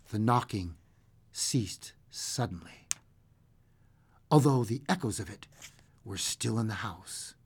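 low, very soft storytelling; hushed suspense narration. A narrator's reading. Reading a scary story about a knock, hushed suspense. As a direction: let the dread hang in a near whisper.

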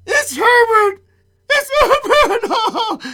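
high, loud shriek of desperate hope; frantic cry. Acted. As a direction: wail the name, stretching it out. Old woman believes son has returned.

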